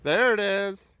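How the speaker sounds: high, loud cheer for a pitch. Real, not acted. Parent filming her first time pitching, cheering. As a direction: call out proudly as it lands.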